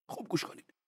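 whispered, high. Acted. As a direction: hush them in. Cleric hushes before quote.

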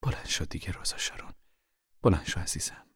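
hushed, tender urging; calm, gentle. Acted. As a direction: coax her softly, like a patient mother. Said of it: "با لحنی آرام گفت".

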